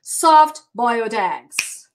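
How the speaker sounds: slow teacher drill; slow model. Shown as a demonstration.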